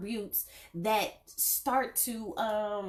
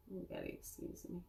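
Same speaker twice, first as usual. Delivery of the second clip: whispered aside; 17 dB quieter, faster than usual. Real, not acted. Aside while fixing messed hair.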